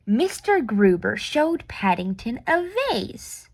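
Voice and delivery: ordinary delivery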